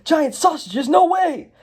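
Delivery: thrilled Emmett. Acted. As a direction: shriek with cartoon delight. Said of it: "Emmett's like".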